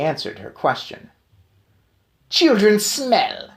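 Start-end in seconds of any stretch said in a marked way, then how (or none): 2.3-3.5 higher, louder, very slow; slow witch scream. Acted. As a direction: spit out the disgust. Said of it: "she screamed".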